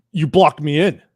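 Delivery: loud imitation of an angry accusation; mock angry. Angry driver accuses narrator in Starbucks, loud mock anger. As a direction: accuse loudly, playing a mad customer. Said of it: "he's already so mad".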